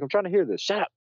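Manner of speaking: high, urgent shout of shut up; frantic high yell to hush everyone. Retold or imitated.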